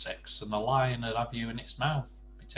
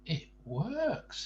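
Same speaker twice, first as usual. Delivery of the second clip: in wonderment; 7 semitones higher than usual. Acted. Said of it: "out loud in wonderment".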